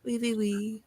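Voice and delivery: mock French accent; French character. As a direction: play a cartoon Frenchman warming up. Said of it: "get into the French character".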